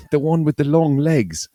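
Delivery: lightly voiced Australian guy; mock Australian man. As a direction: tease like an old Australian ad actor. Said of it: "they're all talking Australian".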